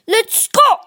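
high, loud excited shout. A narrator's reading. Bear pushing the sled, shouting with glee. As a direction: yell it out as the sled starts. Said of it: "he shouted".

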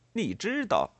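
high-pitched indignant echo; angry. A narrator's reading. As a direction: throw the question back angrily. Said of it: "带着一种愤怒的神气接着说". (愤怒地反问)

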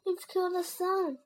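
mildly hammed-up grieving father; moaning. A narrator's reading. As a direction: moan with remorse. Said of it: "Dad moaned".

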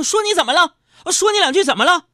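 loud, high mock shouting; angry retort. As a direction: fire back at the wife in hammed-up rage. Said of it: "当时给我气的".

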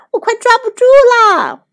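very high, loud cry; desperate shout. A narrator's reading. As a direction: shout it as your grip slips. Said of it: "汤姆大喊着".

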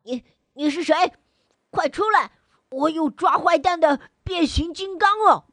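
scared loud shout. Acted. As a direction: bluff bravely while scared. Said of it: "害怕地大声叫道". (害怕地大声喊)

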